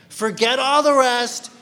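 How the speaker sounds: high, loud dismissal of all others; emphatic declaration. Shown as a demonstration. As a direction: proclaim it with full force. Acting out the emphatic claim.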